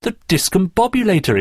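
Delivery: high-pitched exclamation; exclaiming. A narrator's reading. Terry sees the device in the package, exclaiming. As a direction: exclaim it in amazement. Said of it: "exclaimed Terry".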